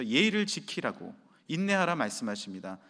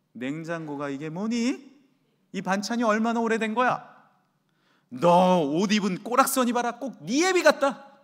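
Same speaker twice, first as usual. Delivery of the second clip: louder, higher, sneering nag; sarcastic nagging; 6 semitones higher, 8 dB louder than usual. Acted. Visiting mother criticizing her married daughter, sarcastic and loud. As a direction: pick apart everything with a sneer.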